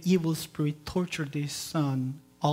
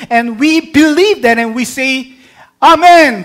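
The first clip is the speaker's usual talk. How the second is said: loud, high preaching voice; full-force preaching; 8 semitones higher, 17 dB louder than usual. Acted. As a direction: proclaim it to the congregation.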